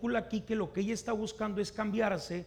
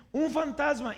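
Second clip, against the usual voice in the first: higher, louder mock fright; alarmed cry; 8 semitones higher, 6 dB louder than usual. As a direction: yelp it as if spotting a ghost. Retelling the disciples' cry of alarm.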